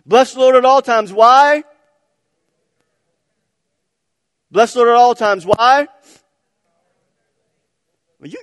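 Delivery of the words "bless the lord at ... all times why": shouted back and forth, higher; rally the crowd with a yell; loud call and response. Real, not acted. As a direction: congregation chant, loud and raised. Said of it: "let's do it one more time".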